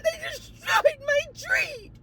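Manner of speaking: slow, very high mock wail; crying imitation. Resident mourning her tree to the manager, crying. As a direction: stretch it into an exaggerated tearful howl. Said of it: "she was literally crying".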